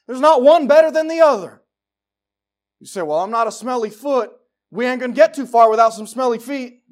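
loud, high fervent sermon shout; full-force preaching. Acted. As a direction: drive the point home to the congregation.

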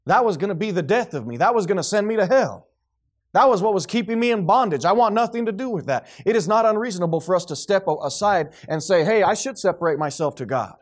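impassioned sermon in full voice; forceful preaching. Real, not acted. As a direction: drive it home to the congregation.

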